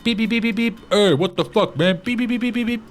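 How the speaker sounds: high squeaky car voice, beeping; talking car voice. Retold or imitated. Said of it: "[name] voices the car".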